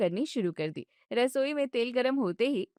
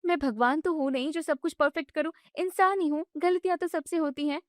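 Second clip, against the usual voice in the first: higher, indignant daughter-in-law voice; young wife protesting; 6 semitones higher than usual. Acted. Bride objects when mother-in-law shuts off the gas, indignant and high. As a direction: push back, hurt and annoyed.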